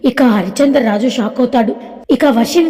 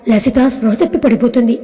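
ordinary delivery, nothing marked

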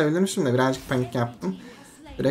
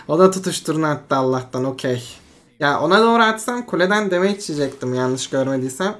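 ordinary delivery

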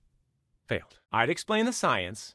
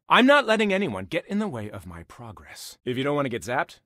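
ordinary delivery

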